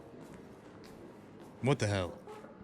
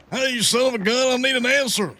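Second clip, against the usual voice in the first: loud, high mock demand; teasing shout; 10 semitones higher, 5 dB louder than usual. Real, not acted. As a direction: call out to him playfully. Yelling at silent player.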